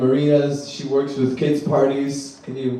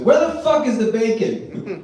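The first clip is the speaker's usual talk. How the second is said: higher and louder, slow and laughing; mock yell; 9 semitones higher, 5 dB louder, slower than usual. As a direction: bark for breakfast like a pushy husband. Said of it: "to yell at women".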